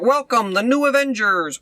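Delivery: high, hammed-up press-conference welcome; grand announcement. Mocking the villain unveiling the team to the press. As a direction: announce it like a showy host. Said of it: "she's like".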